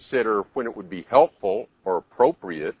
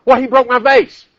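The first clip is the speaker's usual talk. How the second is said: high, loud indignant protest; mock-outraged parent, raised voice; angry parent; 14 semitones higher, 11 dB louder than usual. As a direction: blurt it out in outrage.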